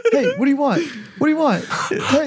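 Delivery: high, jumpy imitation of a scared friend; mock nervous challenge. Acts Brendan nervously facing the man.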